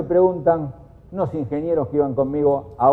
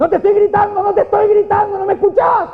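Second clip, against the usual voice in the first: high, loud yell insisting they are not yelling; angry shouted protest in a heated argument; shouting; 7 dB louder than usual. Retold or imitated. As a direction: shout it much higher and louder, ironic denial. Yelling while denying shouting.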